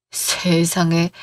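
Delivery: slow, low and drawn out; shocked gasp of disbelief. Acted. Mother-in-law reeling at her daughter-in-law's outburst, slow stunned disbelief. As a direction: let the shock sink in on each syllable.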